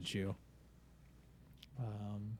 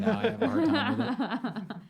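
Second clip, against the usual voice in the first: higher and louder, laughing; 13 semitones higher, 9 dB louder than usual. Real, not acted. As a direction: laugh through the confession. GM admits failing accent, laughing.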